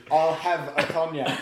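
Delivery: "I'll have a cognac" drawn out slowly, "I'll" leaned on; slow insistent repeat. Shown as a demonstration. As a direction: repeat the order slowly and stubbornly. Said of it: "Well, I said beers".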